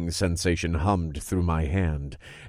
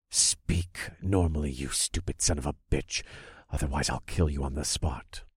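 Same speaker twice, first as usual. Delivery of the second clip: menacing; 4 semitones lower, 7 dB quieter than usual. A narrator's reading. Threatens the possessed man.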